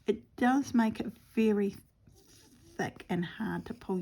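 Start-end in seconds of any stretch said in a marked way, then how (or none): none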